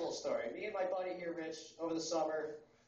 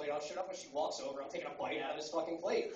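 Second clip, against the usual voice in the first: usual speaking voice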